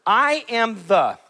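slow, each word set apart, "the" held; deliberate emphasis on "the"; stress on THE. Shown as a demonstration. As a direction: lay out "I am the" slowly. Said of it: "let's look at the third word".

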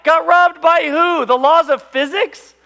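slow, loud, high incredulity; mock the excuse at full volume; retold father's disbelief; exasperated dad. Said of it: "my dad would get mad at me".

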